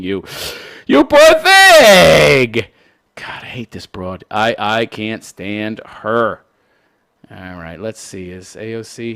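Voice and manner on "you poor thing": much higher, louder, very slow sarcastic coo; hammed-up syrupy sarcasm; mock sympathy. Shown as a demonstration. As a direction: drag out the fake pity on "thing".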